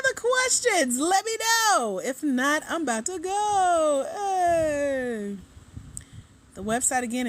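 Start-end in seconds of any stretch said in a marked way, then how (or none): 0.0-5.4 high, loud and drawn-out; singsong call-out. Real, not acted. Host checking for questions before leaving the live, singsong call-out.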